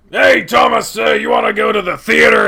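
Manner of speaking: loud, gravelly bear shout; Coach Grifter. Shown as a demonstration. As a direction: holler it like a growly bear coach. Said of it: "And then just that for hours".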